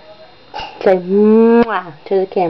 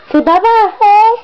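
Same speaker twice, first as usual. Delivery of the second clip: "bye-bye" said much higher, lilting; high coaxing sing-song; 11 semitones higher than usual. Shown as a demonstration. Mom coaxing her baby to say bye, high sing-song. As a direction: lift "bye-bye" way up to coax the baby.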